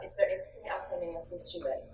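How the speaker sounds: soft, polished, courteous offer. Shown as a demonstration. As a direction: sound refined and gracious. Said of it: "See how polished that sounds?".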